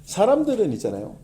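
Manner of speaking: normal talking voice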